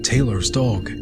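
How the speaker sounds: plain reading voice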